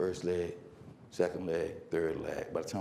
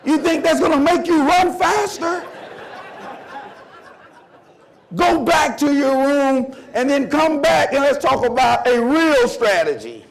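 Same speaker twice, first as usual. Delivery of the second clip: high, loud coach rant; incredulous scolding; 9 semitones higher, 8 dB louder than usual. Retold or imitated. Coach sending runners back for a real strategy, incredulous scolding. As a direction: pile on with loud, pitched-up disbelief.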